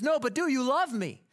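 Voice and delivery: high, loud climactic demand; swelling insistence. Retold or imitated. Tevye cutting off the song to demand love, loud insistent climax. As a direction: belt the question over the rising music. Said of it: "crescendo of music and vocal prominence".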